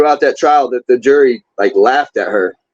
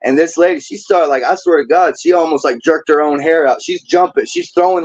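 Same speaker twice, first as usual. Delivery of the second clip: ordinary talk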